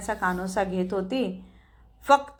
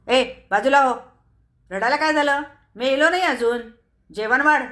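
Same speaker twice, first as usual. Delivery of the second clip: louder, higher barking orders; snapping drunk husband; 5 semitones higher, 6 dB louder, faster than usual. A narrator's reading. Drunk husband snaps at his crying wife, loud and higher. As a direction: snap at the crying wife to serve dinner. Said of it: "तो खेकसला".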